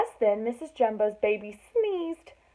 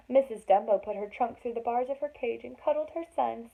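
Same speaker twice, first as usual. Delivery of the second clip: ordinary delivery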